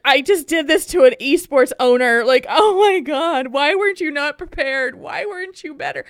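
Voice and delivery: self-scolding. Retold or imitated. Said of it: "I would just be like".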